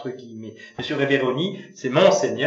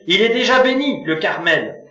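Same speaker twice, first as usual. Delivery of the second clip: higher, louder irritated retort; displeased voice; 7 semitones higher, 9 dB louder than usual. A narrator's reading. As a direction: snap back with annoyance. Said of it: "d'une voix mécontente".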